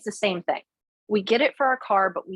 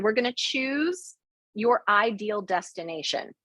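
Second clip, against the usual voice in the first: ordinary talk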